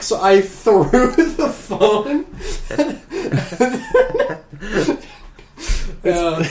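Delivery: giggling at his own mishap; laughing. Real, not acted.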